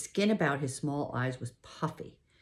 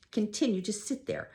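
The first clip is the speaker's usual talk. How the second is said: ordinary delivery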